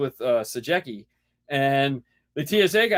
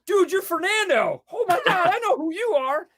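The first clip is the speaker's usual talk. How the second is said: much higher, breathless gushing fan; starstruck fan squeal. Retold or imitated. Imagined fan spotting Fernando at the airport, squealing high. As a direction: gush like a fan who just spotted a celebrity. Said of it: "he'd be going".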